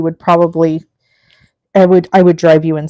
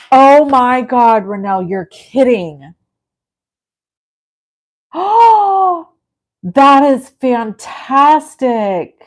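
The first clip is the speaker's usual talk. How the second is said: real astonished delight, higher and drawn out; thrilled surprise; 5 semitones higher, 4 dB louder, much slower than usual. Real, not acted. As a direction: gasp and gush over wonderful news. Reacting to news of homecoming.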